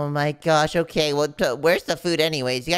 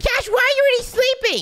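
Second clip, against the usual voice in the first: yelling; 5 dB louder than usual. Acted. Waking sleeping friend.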